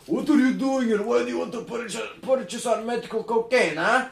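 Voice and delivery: high, hammed-up Russian mafia accent; mock Russian accent. Kids retelling their short film, Russian hat guy questions buyer, mock Russian accent. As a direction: play a suspicious Russian thug in a mock accent. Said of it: "the Russian hat guy goes".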